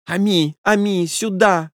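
high-pitched mistress calling her dog; lady calling out. A narrator's reading. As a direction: call the pet over in a high lady's voice. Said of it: "закричала хозяйка".

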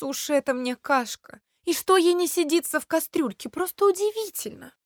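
exasperated cook; despairing cook. A narrator's reading. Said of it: "в отчаянии повторяла".

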